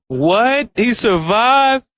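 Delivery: can't believe it, stretch it out high; real disbelief. Real, not acted. Vlogger reacts to Sung surviving, slow stunned disbelief.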